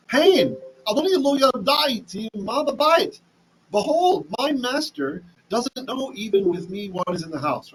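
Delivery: high, earnest protest; Joseph refusing. Acted. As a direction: plead it up high, pushing her away. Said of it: "and he said to his master's wife".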